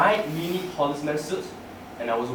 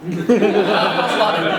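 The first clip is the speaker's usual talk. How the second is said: slow, loud words through laughter; laughing; 5 semitones higher, 8 dB louder, much slower than usual. Real, not acted. To the audience after the heaven joke, laughing loudly. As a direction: laughs at his own punchline.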